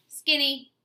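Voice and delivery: high, loud mocking jeer. A narrator's reading. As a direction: fling the word like a playground taunt. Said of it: "he jeered".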